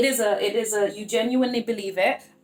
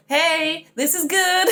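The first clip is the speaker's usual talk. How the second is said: higher, playful "hey" of good news; cheery mock announcement; 6 semitones higher, 4 dB louder, much slower than usual. Reader reframes news for her viewers, playfully cheery. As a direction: act out upbeat good news.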